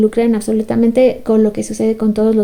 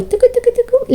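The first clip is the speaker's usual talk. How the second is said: phone ring; 12 semitones higher than usual. Retold or imitated. Imitating phone ringing tone.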